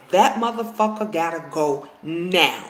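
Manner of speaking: usual speaking voice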